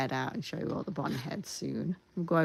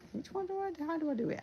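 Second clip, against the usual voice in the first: puzzled high question; 13 semitones higher, 6 dB quieter, faster than usual. Real, not acted. Confused at herself.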